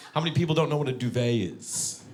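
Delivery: ordinary talk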